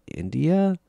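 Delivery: "India?" tossed up high as a questioning mock Indian accent. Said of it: "But it's a stupid accent".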